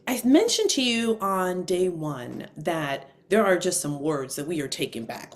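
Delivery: ordinary talk, nothing marked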